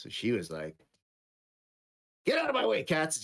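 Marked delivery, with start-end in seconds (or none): 2.3-3.2 high-pitched mock irritation; bossy mean sister. Sister to cats in her way, mock angry. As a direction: play a rude sister, pitched way up.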